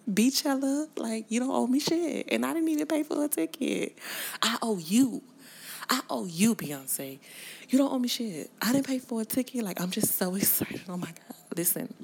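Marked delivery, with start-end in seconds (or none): 0.1-7.2 high, loud excited squeal; excited gushing. Real, not acted. Fan squealing to [name] about the show, thrilled and loud. 7.7-9.6 high, loud thrilled squeal, a bit fast; excited gushing. Real, not acted. Fan squealing to [name] about free show, high and excited.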